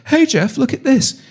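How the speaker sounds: imitated high-pitched eager call; excited call. Co-host shows host a bizarre thing he found, mock excited.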